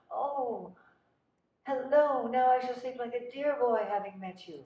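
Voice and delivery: gushing greeting, pitched well up; warm, effusive. Retold or imitated. Olivier greets the actress in his trailer, warm and effusive. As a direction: mimic an effusive star welcoming a newcomer. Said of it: "he was so warm and effusive".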